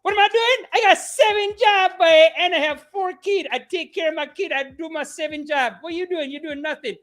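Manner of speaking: put-on high Jamaican accent bragging; Jamaican woman bragging. Sketch woman bragging about her jobs, Jamaican accent. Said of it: "the girl would be like".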